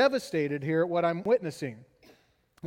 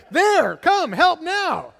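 higher, louder pretend yell; imagined urgent shout; 8 semitones higher, 9 dB louder, much slower than usual. Dad imagines yelling at the doctor to come. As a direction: bark it like dragging him by the tie. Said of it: "be like".

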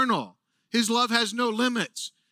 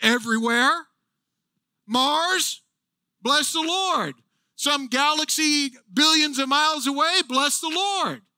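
higher, calling out to distant places; fired-up call; 5 semitones higher than usual. Acted. Preacher calling the universe to praise, excited. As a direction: summon the galaxies to praise. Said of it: "get fired up with you".

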